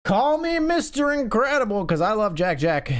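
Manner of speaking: high, punchy exclamation; gleeful high-pitched boast; excited quip. Real, not acted. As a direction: crow it high, then ease down. Cheering for a lucky hand.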